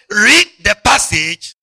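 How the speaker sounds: slow and loud, for the church to repeat; loud, slow congregational call; slow call-and-response. Shown as a demonstration. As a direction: draw it out so they echo it. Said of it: "Everybody say".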